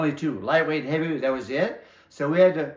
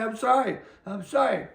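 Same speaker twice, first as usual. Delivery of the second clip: mock crying; 9 semitones higher than usual. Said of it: "He's crying".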